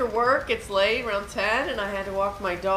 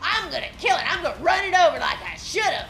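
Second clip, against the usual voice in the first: mock threat; 8 semitones higher, 3 dB louder, faster than usual. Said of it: "and she goes".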